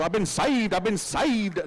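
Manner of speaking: hammed-up revival cry; mock a born-again testimony with punched emphasis; saved convert. Convert dancing a glory jig, in mock fervour. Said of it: "do a little glory freedom jig".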